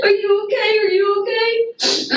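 high, crying plea over him; high tearful cry of are you okay; panicked pleading. Real, not acted. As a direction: beg him to answer, voice cracking. Frantic plea over injured man.

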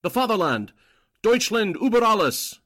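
loud fervent toast, rising high; patriotic cry. Acted. Agent toasting the fatherland with a loud cry. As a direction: shout the toast with zeal.

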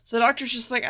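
ordinary talk, nothing marked

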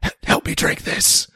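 whispered conspiratorially, as if leaning in close. Retold or imitated. Friend leaning over to Ben, whispered request. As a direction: hush it like a secret between friends.

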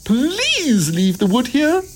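very high, slow, pleading bug voice; begging insects. A narrator's reading. Insects asking Jack to leave the wood, begging. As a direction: plead in a tiny squeak. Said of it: "begged the insects".

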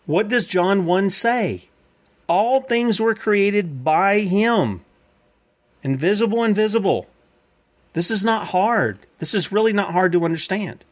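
high, slow and emphatic, every word driven home; preaching at full force. Real, not acted. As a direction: lean in and hammer it slowly.

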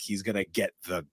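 ordinary delivery

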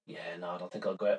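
low, quick murmur for an unwilling dog; reluctant dog. Retold or imitated. Dog answering its owner at the open door, reluctant murmur. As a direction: toss it off quietly, lightly voicing the dog. Acting out her dog's reply.

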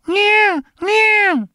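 high squeaky meow imitation; tiny cat voice. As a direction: mimic the cat's little meow. Cat's sneeze-talk imitated.

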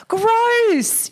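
mock kid disgust. Kids react to wiping, retold.

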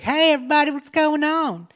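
high cheery greeting. Retold or imitated. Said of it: "she's like".

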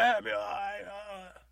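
high, loud anguished yell; shouting at the heavens. Retold or imitated. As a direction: curse the sky at full voice. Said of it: "shouting at the heavens".

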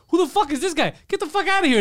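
high, loud mock-hostile shout; hostile newsroom guy. Retelling a show, mock-hostile high shout. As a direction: bark him out of the room in a high voice. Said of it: "the guy's like".

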